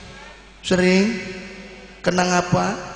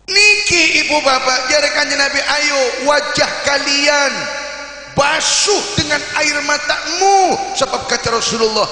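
loud, high shouted sermon on tears before God; fiery preaching; 8 semitones higher, 7 dB louder than usual. Acted. Preacher to congregation about weeping for sins, loud fervent preaching. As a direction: thunder it at the congregation like a revival preacher.

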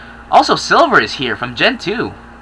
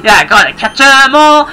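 put-on whiny kid voice; 9 semitones higher, 3 dB louder than usual. Kid pleading to mom.

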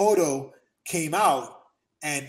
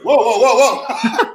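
laughing mock alarm; 9 semitones higher, 12 dB louder, slower than usual. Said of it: "And he goes".

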